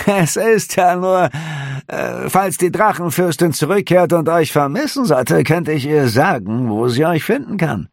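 high, hesitant fawning voice; smooth it over, servile; fawning innkeeper. A narrator's reading. Innkeeper excusing himself to the officer, fawning.

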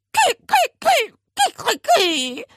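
high, loud broken crowing; chopped crows that sink in pitch; protesting parrot. A narrator's reading. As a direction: squawk in protest, dropping as you go. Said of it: "protestierte der Papagei".

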